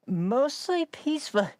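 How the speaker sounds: high, drawn-out incredulous echo; mocking disbelief. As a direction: stretch it out high in mock disbelief. Scoffs at repeated media claim.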